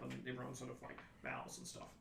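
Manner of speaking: ordinary delivery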